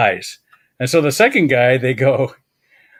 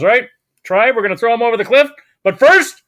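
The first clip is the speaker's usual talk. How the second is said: high, loud rallying call to the tribe; booming chief; 9 semitones higher, 7 dB louder than usual. Acted. The chief calls out to his whole tribe, loud and high. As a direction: announce it like a gleeful chief.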